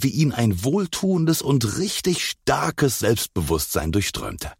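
plain reading voice

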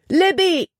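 very high urgent cry; screaming her name. A narrator's reading. Jordan sees Libby in the torrent, screaming. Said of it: "schrie Jordan".

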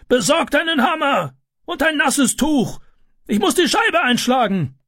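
very high, held calling pitch; urgent calling. A narrator's reading. Neighbour demands hammer and wet cloth, urgent calling. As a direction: call out orders over a commotion. Neighbour shouting orders at fire.

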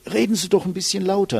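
mock call of a listener who can't hear; annoyed listener. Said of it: "nicht richtig versteht und sagt".

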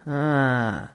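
drawn-out, indifferent "ah". A narrator's reading. Tobacco chewer to a man who refused tobacco, indifferent. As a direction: let out an unconcerned, falling "ah". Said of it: "сказал тот равнодушным тоном".

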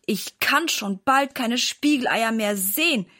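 ordinary delivery

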